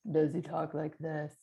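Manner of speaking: loud put-on voice at normal pitch; worry character voice. Shown as a demonstration. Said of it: "You could give it a voice".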